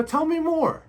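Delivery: high, loud show of interest; eager prompting. Shown as a demonstration. Husband urging his wife to keep venting, eagerly. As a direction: egg her on in a lifted voice.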